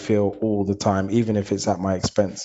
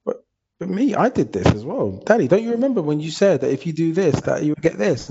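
put-on child voice; 8 semitones higher than usual. Kids voice quoted to dad.

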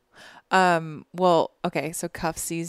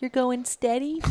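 high, prim fifties housewife voice; 1950s housewife; 7 semitones higher than usual. Retold or imitated. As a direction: ask it like a shocked old-fashioned housewife. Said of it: "I just sounded like a nineteen fifties housewife".

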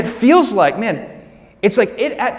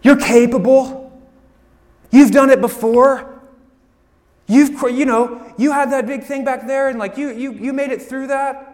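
higher, slow cheerleading friend; friend's pep talk; 7 semitones higher, 5 dB louder than usual. Retold or imitated. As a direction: pump up a scared friend. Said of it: "They go".

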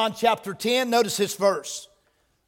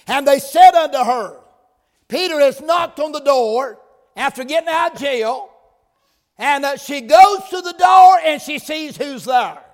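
louder, rising storytelling sermon; fervent preaching; 2 semitones higher, 4 dB louder than usual. Acted. Preacher telling Peter's escape to the church, fervent. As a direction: build the story with fire.

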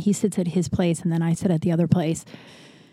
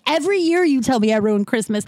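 higher-pitched, exasperated insistence; losing it; 5 semitones higher than usual. Retold or imitated. Arguing back at her father, exasperated retelling. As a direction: insist hotly, as in a retold row. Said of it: "I lost it".

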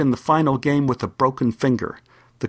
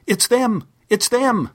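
mock excited scream; 10 semitones higher, slower than usual. Boy spots the football team, high mock scream. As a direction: shout it up high like a boy spotting his heroes, no real volume. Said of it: "Paul screamed".

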